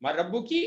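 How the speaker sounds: मर रब्बू की with a lifted की at the end; teacher modelling the feminine form. Shown as a demonstration. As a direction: land on the feminine ending की, rising. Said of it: "औरत होगी तो उसे क्या कहा जाएगा".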